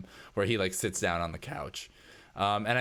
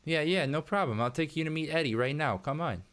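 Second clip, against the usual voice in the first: higher, easygoing character voice; helpful Sal, obliging; 7 semitones higher than usual. Acted. Worker offering to take you to Eddie, higher obliging character voice. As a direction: breezily agree to lead the way.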